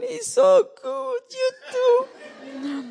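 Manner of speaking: high, slow, tearful imitation of sobbing words; imitating crying. A woman crying as she says bye-bye, imitated high and tearful. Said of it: "she started crying and crying".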